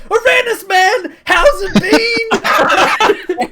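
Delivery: very high, loud, drawn-out hail; excited greeting. Acted. Rock hailing Sindolas as an old pal, loud excited greeting. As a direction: holler and stretch the last word.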